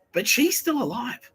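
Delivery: slow, high "but she's still alive"; high incredulous protest. Retold or imitated. Protesting to the voices, high and slow. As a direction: squeak it out slowly, baffled. Objecting to the reincarnation claim.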